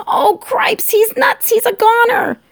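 very high, loud squeaky shout; Furlow. Acted. Mouse decides his brother is doomed, shouting. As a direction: shriek it in mounting alarm. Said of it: "shouted Furlow again".

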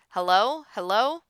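loud, questioning, rising at the end; calling into phone. Acted. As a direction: check if anyone is on the line.

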